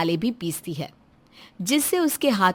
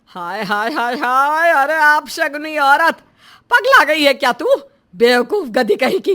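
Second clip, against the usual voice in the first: louder, outraged wail building into insults; shrieked scolding; 3 semitones higher, 5 dB louder than usual. Acted. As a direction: scold her loudly in horrified outrage. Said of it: "इतना क्यों चिल्ला रही हो". (चिल्लाकर डांटते हुए)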